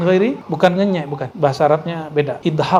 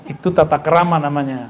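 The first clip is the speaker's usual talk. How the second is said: ordinary delivery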